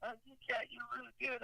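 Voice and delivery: a high Southern voice, fumbling for a compliment; southern accent. Retold or imitated. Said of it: "with a southern accent".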